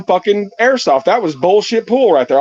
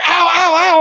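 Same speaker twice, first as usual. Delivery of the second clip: mock yelps of pain; 11 semitones higher than usual. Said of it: "I hear".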